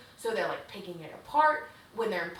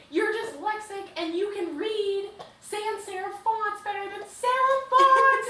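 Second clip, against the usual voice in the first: much higher and louder, half-singing the words; mock screaming; 11 semitones higher, 9 dB louder than usual. Declan yelling back at Iris in a lovers' quarrel, mock shout. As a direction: yell it like a lovers' fight. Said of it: "they're screaming at each".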